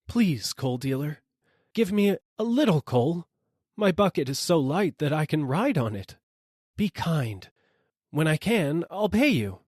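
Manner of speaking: poor rider begging the dealer for coal; pleading rider. A narrator's reading.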